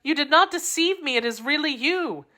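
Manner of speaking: put-on high, joyful young woman; a lover reunited, breathless with joy; Constance's voice. A narrator's reading. Constance greets D'Artagnan at last, high and joyful. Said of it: "Constance greets D'Artagnan, overjoyed".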